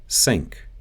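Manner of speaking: "Sink" with an S for TH; Russian S for TH in "Sink"; Russian accent. Shown as a demonstration. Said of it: "The T H sound".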